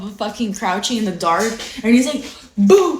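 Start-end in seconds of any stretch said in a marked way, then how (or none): 2.6-3.0 louder, higher mock "boo"; spring it on someone suddenly; playful scare shout; imitated jump scare. Grandson jumps out to scare grandma.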